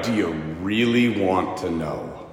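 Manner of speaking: low, slow, serious question; weighty, drawn-out asking; grave. Retold or imitated. Steve gravely checks she means it.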